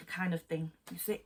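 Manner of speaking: ordinary talk, nothing marked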